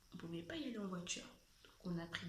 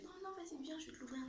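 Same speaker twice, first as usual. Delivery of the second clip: much higher insistent sister voice; imitating pushy sister; 9 semitones higher, faster than usual. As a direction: press the offer in a squeaky sibling voice. Sister offers to open bag.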